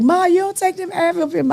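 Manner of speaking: high, thin imitation of the daughter; daughter's voice. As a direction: mimic a scolding daughter. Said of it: "my daughter's like".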